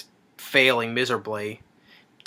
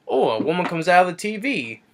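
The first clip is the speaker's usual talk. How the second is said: swooping, higher-pitched sarcastic "oh"; mock amazement; 5 semitones higher than usual. Mocking the guy who accepts the TV woman, sarcastic wonder. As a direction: put on a hammy, naive wonder. Mocking the guy's unbothered reaction.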